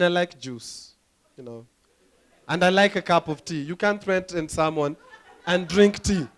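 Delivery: ordinary talk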